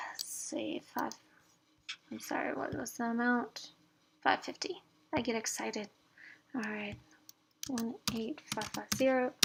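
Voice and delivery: soft, half-whispered talking to yourself; muttering to herself. Real, not acted. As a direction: murmur it absently while working out figures. Talking to herself entering numbers.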